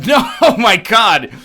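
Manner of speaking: loud, high-pitched scandalized local; hammed-up appalled Angeleno; mock outrage. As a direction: blow up in exaggerated horror at the suggestion. Acts out appalled LA local's reply.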